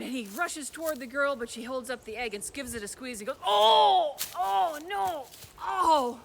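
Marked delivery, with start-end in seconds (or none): 3.4-6.2 loud, higher pained groans; troll howling as his heart is crushed. Acted. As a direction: cry out as the heart is squeezed.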